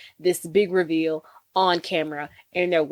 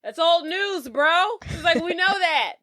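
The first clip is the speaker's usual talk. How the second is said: mock scorn; 9 semitones higher, much slower than usual. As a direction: toss it back as obvious old news. Retelling film villain's scornful admission.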